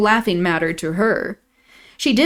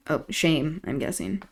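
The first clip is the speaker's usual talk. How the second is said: ordinary delivery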